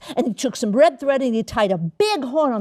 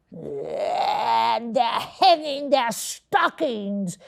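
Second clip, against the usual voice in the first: snarled with a sneer; much slower than usual. A narrator's reading. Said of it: "he snarled with a sneer".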